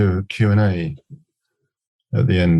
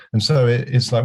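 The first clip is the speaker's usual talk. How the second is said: ordinary talk, nothing marked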